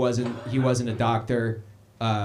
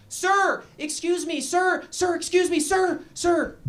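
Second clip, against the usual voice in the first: much higher, calling after him urgently; high urgent stranger; slower than usual. Retold or imitated. Stranger in the hospital hallway calling after dad, high and urgent. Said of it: "they were like".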